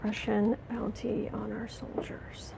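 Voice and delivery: a hushed, appalled whisper; disbelieving whisper. Real, not acted. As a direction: breathe it out in shocked disbelief. Repeats the news in disbelief.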